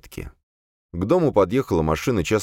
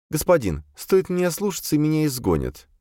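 high, deferential female servant voice; servant demoness explaining; 11 semitones higher than usual. A narrator's reading. Captive demoness justifies herself to her master, high servant voice. Azalia's voice in story.

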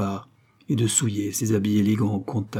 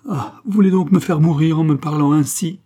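higher, louder plea; an impassioned cry of reproach; anguished protest; 6 semitones higher, 7 dB louder than usual. Acted. Concepcion pleads with the man kissing her hands, anguished. Lover protests in tears, acted.

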